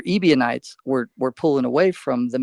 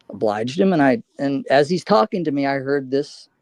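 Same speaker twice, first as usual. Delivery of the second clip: normal talking voice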